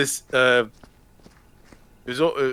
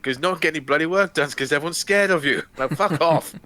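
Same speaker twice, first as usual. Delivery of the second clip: higher, booming telling-off; Brian Blessed voice; 5 semitones higher than usual. Retold or imitated. Blessed ordering Dino De Laurentiis off set, booming. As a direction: bellow at Dino to get off set. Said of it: "saying, like".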